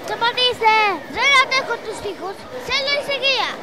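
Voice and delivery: put-on child shouting over a noisy market crowd, begging for silence; overwhelmed shout. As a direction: yell as if the noise is too much.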